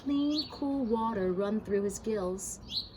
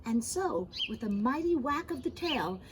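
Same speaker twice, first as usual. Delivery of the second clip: plain reading voice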